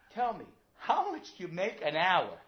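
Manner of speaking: high, loud, drawn-out demand; insistent child. Acted. Child demanding to know Dad's hourly pay, loud insistence. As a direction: belt out the question slowly like a persistent kid.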